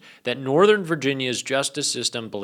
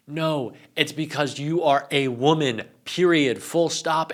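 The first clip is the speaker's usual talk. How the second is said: ordinary talk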